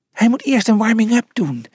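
high-pitched little mouse voice; high, insistent exclamation; exclaiming. A narrator's reading. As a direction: pipe up urgently in a high voice. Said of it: "riep Pliny uit".

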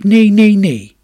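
high, loud repeated cry of protest; urgent storybook shout; calling out no. A narrator's reading. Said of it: "maar jij riep".

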